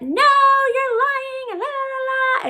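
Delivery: mock screaming. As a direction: imitate her shrieking denial. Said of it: "she's screaming and hollering".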